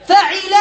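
فعل said as fa-ʕi-la; kasra on the ʕayn. Shown as a demonstration. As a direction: say فعل with "i" in the middle. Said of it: "بكسر العين".

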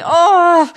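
loud, high, drawn-out aww; adoring "aww". Real, not acted. Co-host melting over adorable children, gushing aww. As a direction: belt out a helpless squeal of adoration. Overcome by cuteness, then apologizes.

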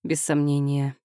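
plain reading voice

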